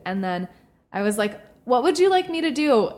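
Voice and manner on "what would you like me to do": high exasperated question. Retold or imitated. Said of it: "that's what I said".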